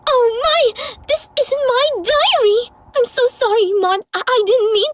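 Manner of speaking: high, flustered gasp of dismay; embarrassed high surprise; shocked realization. Acted. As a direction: suddenly realize the mistake. Realizes she read the wrong diary.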